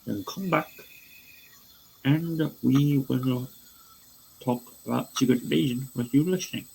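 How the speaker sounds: a bad, lightly done English Cockney accent; half-hearted Michael Caine English accent with big pitch jumps; shitty Michael Caine accent. Podcast host giving listeners the spoiler warning, in a shaky Michael Caine English accent. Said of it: "I'm just gonna do a shitty Michael Caine accent".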